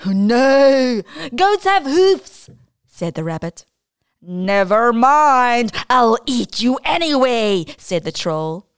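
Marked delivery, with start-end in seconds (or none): 0.1-2.5 high, loud, slow correction; rabbit voice. Acted. 4.2-7.7 troll voice. Acted. Troll threatens rabbit.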